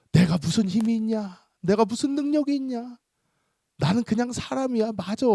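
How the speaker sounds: high, rhetorical self-deprecation; mock concession. Pastor concedes weakness to a demon, high rhetorical tone. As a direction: concede with exaggerated rising pitch.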